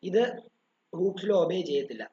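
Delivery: ordinary talk, nothing marked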